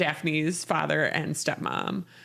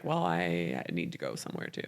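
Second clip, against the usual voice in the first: much lower and softer, a mocking put-on; mock ex-girlfriend voice; 11 semitones lower, 7 dB quieter than usual. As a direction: drop it low and quiet to mock her excuse. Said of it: "'Cause she's like".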